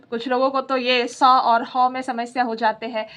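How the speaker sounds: ordinary talk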